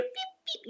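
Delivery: short high beeps; imitated chirps. Retelling the walk in the woods, imitated chirping. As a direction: mimic little chirps heard in the woods. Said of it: "And you hear".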